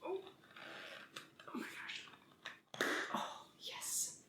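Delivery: strained breathy whisper while twisting; gasping with effort at the lid; opening a stuck jar. Real, not acted. Let's see if I can open it.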